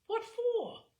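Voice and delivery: high, scornful "What for?"; a retold mother's sharp put-down; mock dismissive mother. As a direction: toss it off like an unimpressed mother. Mother dismisses bra request.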